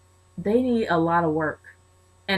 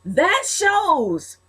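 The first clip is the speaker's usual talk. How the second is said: high, slow, drawn-out declaration; emphatic excitement; 10 semitones higher, much slower than usual. Real, not acted. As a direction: proclaim it word by word, elated. Fan elated at a win.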